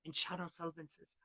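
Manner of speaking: plain reading voice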